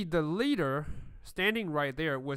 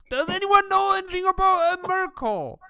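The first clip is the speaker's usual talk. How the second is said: much higher, louder baffled question; confused high voice; 11 dB louder than usual. Acted. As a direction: squeak out the puzzled question. Dwarves confused muttering.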